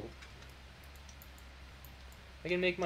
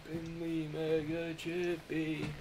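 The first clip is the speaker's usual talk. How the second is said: slow, odd put-on voice; silly character voice; 6 dB quieter, much slower than usual. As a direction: drag it out in a goofy voice. Streamer goofing in silly voice.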